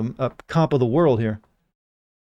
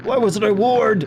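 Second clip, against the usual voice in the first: mock agony; 8 semitones higher, slower than usual. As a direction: wail it like a melodrama victim.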